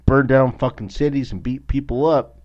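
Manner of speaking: furious shout about rioters; angry outburst. Real, not acted. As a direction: spit it out in real anger. Ranting about violent groups.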